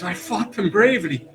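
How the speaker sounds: high, loud, laughing boast; excited boast. Acted. Gunthor to his mother, high loud excited boast. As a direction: crow about the kill with a laugh. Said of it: "really excited that he killed these bugbears".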